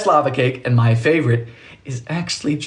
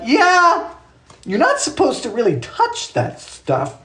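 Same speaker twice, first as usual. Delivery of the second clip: lightly voiced dad, pitched up; imitated dad; 9 semitones higher, slower than usual. Dad warning about fiberglass, higher drawn-out voice. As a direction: draw out a dad's warning, slowly. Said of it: "my dad's like".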